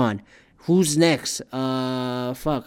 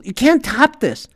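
exasperated outburst; 11 semitones higher, 6 dB louder than usual. Retold or imitated. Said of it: "And then I just go".

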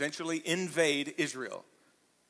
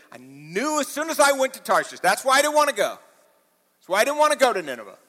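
higher, exasperated outburst at God; mock angry Jonah; 7 semitones higher, 6 dB louder than usual. Preacher voicing Jonah's angry prayer at God, higher. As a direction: Jonah griping, pitched higher. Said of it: "Jonah's really mad".

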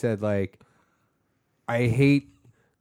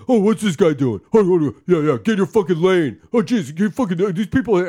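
loud, higher, imitated driver rant; dad's road rage; 6 semitones higher, 6 dB louder than usual. Dad on the phone ranting at drivers, loud mock anger. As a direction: break off to yell at traffic as dad. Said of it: "answers the phone and he's driving".

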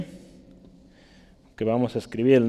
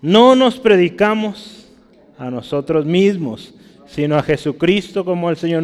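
emphatic preacher's reading, raised voice; scripture proclamation; 6 semitones higher, 6 dB louder than usual. Real, not acted. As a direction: declaim the verse to the congregation. Reads the verse aloud emphatically.

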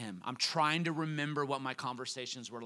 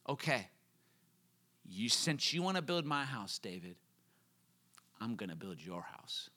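softer and lower, a tender promise to David; gentle promise; 5 semitones lower, 7 dB quieter than usual. Retold or imitated. The Lord gently promises David a house, softer and lower. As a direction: ease down into a quiet, kind reply.

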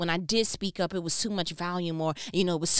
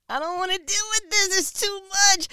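much higher and louder whine; overwhelmed peacemaker; 12 semitones higher, 7 dB louder than usual. Retold or imitated. Her peacemaker side whining about the conflict. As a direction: plead to be left alone. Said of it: "the peacemaker in me".

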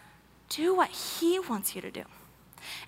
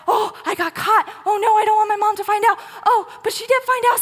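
put-on high, frantic caught-kid voice; panicked kid; 7 semitones higher, 6 dB louder than usual. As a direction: squeal it like a kid who got busted. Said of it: "it's like".

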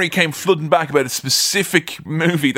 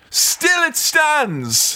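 much higher, very slow, drawn-out grand declaration; mock-epic proclamation; 11 semitones higher, much slower than usual. Mock-epic boast to cohost about his Hobbit Blu-ray, high and slow. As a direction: proclaim it like the last line of an epic.

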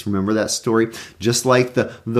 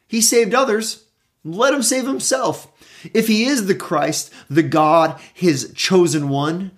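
high mocking sneer at a higher pitch; scoffing rulers; 7 semitones higher than usual. A narrator's reading. Rulers jeering at the crucified Jesus, high mocking sneer. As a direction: taunt him like a scornful ruler. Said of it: "scoffed at him, saying".

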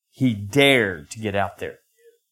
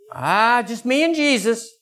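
high, breezy self-assurance; mock the loner believer; airy high dismissive voice; mock-pious boast; 10 semitones higher than usual. Mocking self-reliant believer.

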